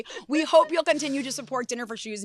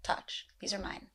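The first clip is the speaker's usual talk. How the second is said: hushed possessive; 11 dB quieter than usual. Acted. Possessive hushed warning over shoes.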